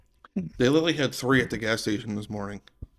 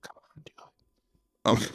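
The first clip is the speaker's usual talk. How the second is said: whisper; 5 dB quieter than usual. Acted. Urging sponsor aside.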